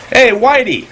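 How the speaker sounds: high calling yell; mock yell to summon the cats. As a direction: call the cats from across the house. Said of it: "all Trump has to do is yell out".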